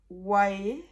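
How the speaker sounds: ordinary delivery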